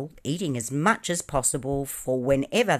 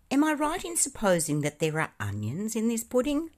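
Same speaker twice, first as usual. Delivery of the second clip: higher, casually offhand question; affected carelessness; 10 semitones higher than usual. A narrator's reading. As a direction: ask it lightly, as if it hardly matters. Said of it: "he said carelessly".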